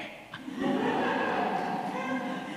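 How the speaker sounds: real burst of laughter, high and airy; big laugh. Real, not acted. Survivor laughing at the gentleman who looks like her father. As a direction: let a full laugh break out.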